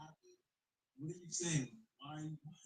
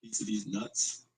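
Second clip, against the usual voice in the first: loud joke; 4 semitones lower, 17 dB louder, slower than usual. Acted. Joking retort.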